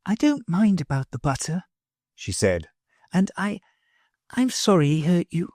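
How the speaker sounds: a hint of a timid girl; timid little girl. A narrator's reading. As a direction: make peace shyly. Sylvie's voice held.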